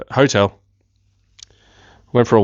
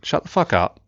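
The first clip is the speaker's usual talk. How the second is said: inner voice; 6 semitones higher than usual. Retold or imitated. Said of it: "that little voice in my head".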